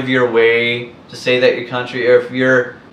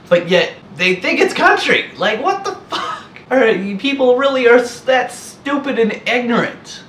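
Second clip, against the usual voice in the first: high-pitched, laughing disbelief; can't believe how dumb they are, laugh through it; incredulous disbelief; 11 semitones higher than usual. Real, not acted. Ranting at wannabe country folks, high mocking disbelief.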